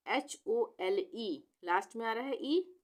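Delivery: usual speaking voice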